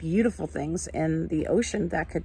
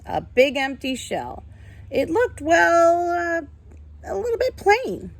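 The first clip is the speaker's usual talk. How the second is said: lively, sing-song storybook reading, higher than her chat; reading to children; 5 semitones higher than usual. Acted. Teacher reading a picture book to her class, animated storytelling. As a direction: read it like a picture book for little ones.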